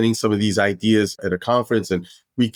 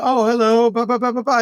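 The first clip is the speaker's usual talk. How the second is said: starstruck greeting; 13 semitones higher than usual. Retold or imitated. Derek meeting a star, high gushing mock greeting. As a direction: play it cool and fail. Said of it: "I was like".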